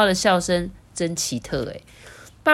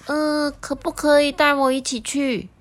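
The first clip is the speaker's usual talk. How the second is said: higher, timid request; a hint of a strange little voice; 9 semitones higher than usual. The schoolbag asking to come along, timid and higher. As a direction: ask shyly, like an unseen creature. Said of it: "突然传来一个声音".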